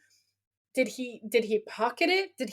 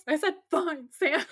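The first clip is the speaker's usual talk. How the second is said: higher-pitched mock exasperation; exasperated insistence; 4 semitones higher than usual. [name] answers Sam's doubting repeat, exasperated. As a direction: act out a fed-up agreement. Retold quarrel, repeating his agreement.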